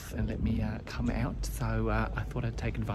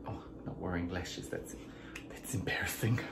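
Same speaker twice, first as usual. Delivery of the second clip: embarrassed whisper; 4 semitones lower, 10 dB quieter than usual. Real, not acted. Notices she isn't wearing lashes.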